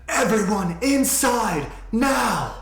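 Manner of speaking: drawn-out, high, booming command. Acted. High Lady orders the townspeople indoors, booming shout. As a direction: boom it out slowly at full volume. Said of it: "a booming voice".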